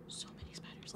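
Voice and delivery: hushed creeped-out whisper. Real, not acted. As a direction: breathe it out under your breath. Creeped out by spiders.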